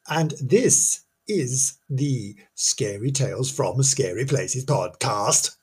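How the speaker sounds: ordinary delivery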